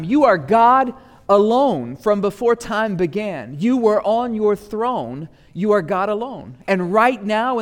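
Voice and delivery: high, forceful sermon delivery; preaching. Real, not acted. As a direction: proclaim it to the congregation with full conviction.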